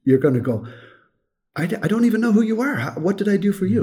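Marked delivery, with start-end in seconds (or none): 1.6-3.8 much higher, bewildered protest; baffled. Acted. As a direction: blurt it out high, totally puzzled. Acts out baffled listener's reply.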